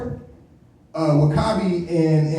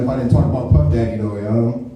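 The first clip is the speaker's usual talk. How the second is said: ordinary talk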